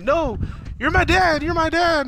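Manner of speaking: high, loud imitation of a pleading kid; mock tearful child. Retelling a son begging his dad, mock tearful plea. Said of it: "And he starts crying".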